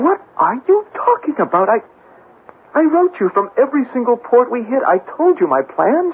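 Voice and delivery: can't believe what he hears; agitated disbelief. Acted.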